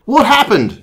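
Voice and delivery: high startled British question; alarmed British doctor. Acted. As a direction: ask in storybook alarm.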